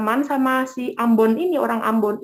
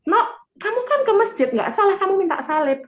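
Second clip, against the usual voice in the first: put-on higher-pitched quote of a questioning older woman; voiced concerned elder; 7 semitones higher than usual. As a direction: ask it high, as the puzzled head nurse. Acted question of the nurse's head.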